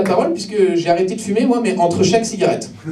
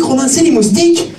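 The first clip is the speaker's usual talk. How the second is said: laughing insistence; 12 semitones higher, 5 dB louder than usual. Acted. Addict insists on the excuse.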